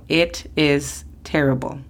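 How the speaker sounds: It is terrible said slowly and clearly; slow model sentence. Shown as a demonstration. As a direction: demonstrate carefully. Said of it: "podemos decir".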